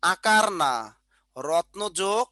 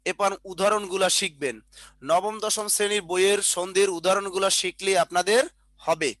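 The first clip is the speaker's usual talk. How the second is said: ordinary talk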